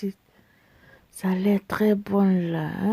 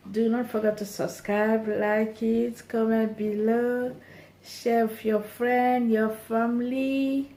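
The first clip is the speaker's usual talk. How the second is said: ordinary delivery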